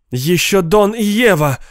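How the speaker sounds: high-pitched protesting cry; insistent mild yell. A narrator's reading. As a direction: shout it out, pitch jumping up. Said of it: "закричала госпожа Катчинсон".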